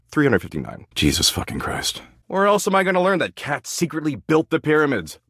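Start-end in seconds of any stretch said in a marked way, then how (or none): 2.3-5.2 high-pitched, indignant rhetorical question. Acted. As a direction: protest as if it were obvious. Defending the doomscrolled posts.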